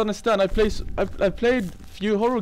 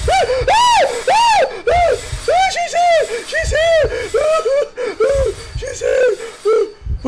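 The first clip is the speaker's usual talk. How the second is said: very high, loud panicked screams; terrified shrieking; 3 dB louder, much slower than usual. Real, not acted. Streamer spotting the ghost, shrieking. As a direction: shriek as she catches up.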